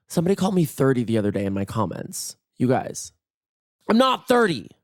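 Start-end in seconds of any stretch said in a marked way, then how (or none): 3.9-4.7 high-pitched playful protest; mock indignation. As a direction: push back in a high, offended voice. Mock outrage at being called thirty.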